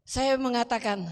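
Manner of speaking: ordinary delivery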